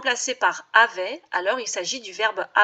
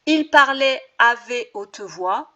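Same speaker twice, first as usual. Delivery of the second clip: slow, deliberate test sentence with "avait" in place of "à"; slow wrong version; 4 dB louder, slower than usual. Shown as a demonstration. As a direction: spell out the wrong swap slowly. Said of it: "pas correct".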